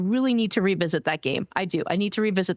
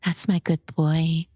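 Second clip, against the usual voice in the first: put-on playful low coo calling her friend a good boy; teasing good boy; 5 semitones lower than usual. Teasing streamer friend Draco.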